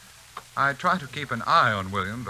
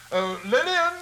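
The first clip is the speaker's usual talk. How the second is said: calling out; 11 semitones higher than usual. Acted. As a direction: call up the stairs, pitched high. Calling wife from downstairs.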